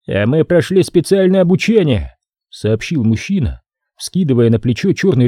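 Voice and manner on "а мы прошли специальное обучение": higher, boastful man's voice; a proud worker bragging; cocky worker. Acted. Mike voiced by narrator.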